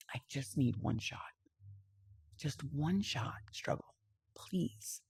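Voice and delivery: soft, whispered plea; tearful begging. Retold or imitated. Asking him for one shot, soft tearful plea. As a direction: beg quietly, near tears. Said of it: "I'm bawling. I'm crying".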